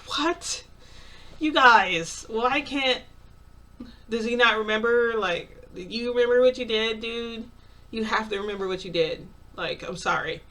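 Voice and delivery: high, loud incredulous outburst; rising disbelief, then softening to an apology; shocked disbelief. Retold or imitated. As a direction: react as if he's lost his mind.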